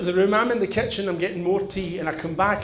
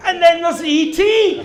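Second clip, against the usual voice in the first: high, loud comic punchline; shouted reveal; 12 semitones higher, 10 dB louder than usual. Acted. Comedian revealing the card on the mantelpiece, shouted.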